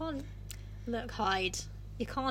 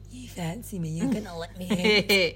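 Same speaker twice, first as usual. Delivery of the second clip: seductive Hyde; 5 semitones lower than usual. Retold or imitated. Hyde tempting Jekyll, low and coaxing. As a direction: coax him in low and sly. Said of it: "Hyde's now like".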